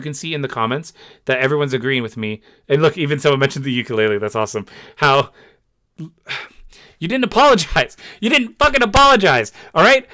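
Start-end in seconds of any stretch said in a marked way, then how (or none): 5.0-10.1 much higher and louder, building in fury; furious shout. Real, not acted. Vlogger raging that the creator never apologized, loud furious yell. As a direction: yell it in disbelief, rising anger.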